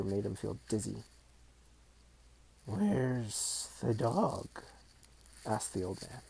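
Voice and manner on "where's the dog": put-on slow, croaky old man. A narrator's reading. Old man questions Billy.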